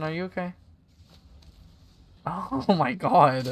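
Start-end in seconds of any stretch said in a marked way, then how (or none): 2.2-3.5 loud, drawn-out real shock. Real, not acted. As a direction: stretch it out, loud and higher, aghast. Discovers Anderson's body in game.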